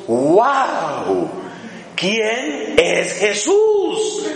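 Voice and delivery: put-on high, slow, wide-eyed child voice; awed little boy. Little boy in church amazed his father kneels, high and slow. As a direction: marvel like a small boy seeing his hero kneel. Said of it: "qué fue lo que este hombre sintió".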